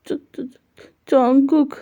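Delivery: tearful stammer. Acted. Shy boy stammers his name.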